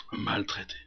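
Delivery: very soft, low, nearly whispered; hushed echo. Acted. Preacher echoing the mistreatment to the congregation, hushed. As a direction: let the word fall away almost voiceless. Same word dropped to near whisper.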